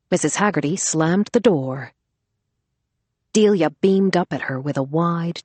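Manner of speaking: usual speaking voice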